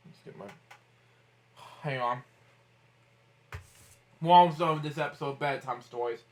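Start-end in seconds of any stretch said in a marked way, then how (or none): none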